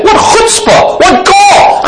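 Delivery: high, ringing cry of mock outrage; exclaiming shout. Real, not acted. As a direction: throw both phrases up high at full voice. Preacher exclaims at his own boldness.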